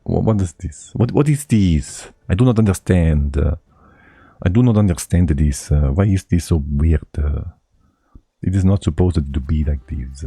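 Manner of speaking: a bad mock Italian accent, playing confused; poor Italian accent. Baffled by the map, a streamer puts on a poor Italian accent. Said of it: "that was my poor Italian accent".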